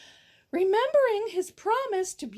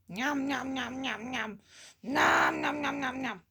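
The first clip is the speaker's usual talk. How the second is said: nom nom eating sounds; 5 semitones lower, 4 dB quieter than usual. Acted. Merchant eating the dinner.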